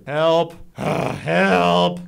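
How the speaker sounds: bored, half-hearted cry for help; listless damsel going through the motions. Acted. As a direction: call for help with a weary sigh, not scared. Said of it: "She sounds kinda bored".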